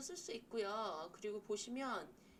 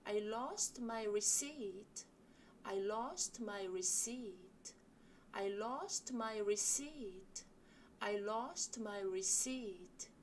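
slow model sentence; much slower than usual. Shown as a demonstration. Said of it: "같이 이 문장 연습해 보실게요".

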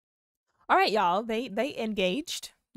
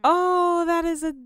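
high, loud gushing; delighted scream; 9 semitones higher, 8 dB louder, faster than usual. Real, not acted. Gamer watching her Sims' flower gift, gushing scream. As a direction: squeal it out, overcome by cuteness. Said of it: "I'm screaming in y'all ears".

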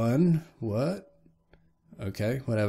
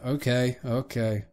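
ordinary talk, nothing marked

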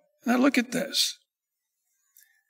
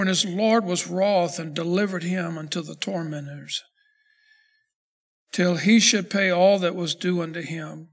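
measured, lower Bible reading voice; scripture reading; 5 semitones lower than usual. Acted. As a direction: read the parable steadily and solemnly. Reads verse thirty-four.

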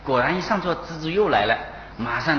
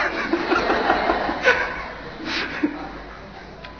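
very high, breathy laugh rising. Real, not acted. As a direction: laugh hard at the silly plan. Laughs at stabbing plan. (高声大笑)

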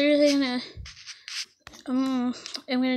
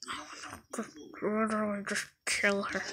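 menacing mutter; 3 semitones lower, 9 dB quieter, slower than usual. Acted. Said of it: "she's like".

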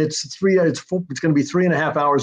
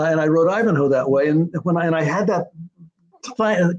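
normal talking voice

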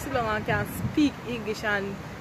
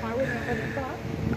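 soft, slow put-on voice; hushed, slow mock voice; mocking quote; 9 dB quieter, slower than usual. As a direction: play the prissy person who'd never do that. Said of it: "people that go like this".

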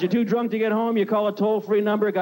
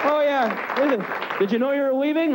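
cop voice; 7 semitones higher than usual. Acted.

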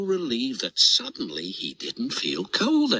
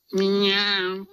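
cat meow; 7 semitones higher than usual. Acted. Cat noise in the scene.

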